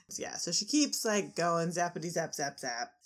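ordinary delivery

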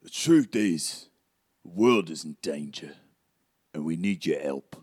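grave character voice; Hagrid. Acted. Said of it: "He says".

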